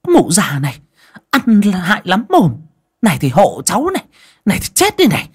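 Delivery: high, furious abuse rising in pitch; vicious cursing. A narrator's reading. Daughter-in-law slaps old mother-in-law, cursing furiously. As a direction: curse her as if landing each slap. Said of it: "vả đôm đốp vào miệng mà chửi".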